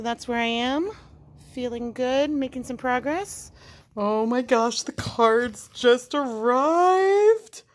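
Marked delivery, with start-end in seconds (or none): 4.0-7.6 louder and slow, thrilled; light up as the package lands; excited surprise. Real, not acted. A maker seeing her new cards arrive, slow thrilled exclamation.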